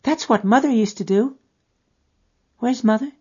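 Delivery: put-on high child's voice; a five-year-old asking innocently; little girl. Little girl asking the man about her mother, high child's voice.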